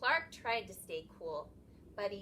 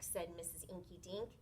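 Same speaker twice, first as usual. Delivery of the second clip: ordinary delivery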